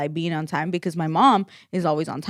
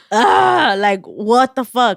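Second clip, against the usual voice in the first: high, loud grossed-out groan then curse; disgusted exclamation; 3 semitones higher, 6 dB louder, much slower than usual. Retold or imitated. Imitating friend splashed with juice, grossed out. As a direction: mimic a friend's disgust at a spill. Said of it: "she was like".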